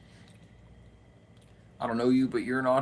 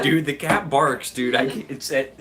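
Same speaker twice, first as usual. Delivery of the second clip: ordinary talk, nothing marked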